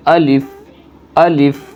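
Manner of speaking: "الف" said slowly and cleanly; letter demo. Shown as a demonstration. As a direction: model "الف" as a teacher. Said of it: "اس طرح سے بولنا ہے".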